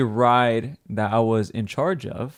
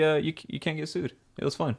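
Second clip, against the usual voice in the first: normal talking voice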